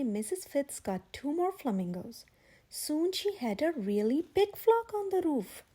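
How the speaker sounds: ordinary delivery, nothing marked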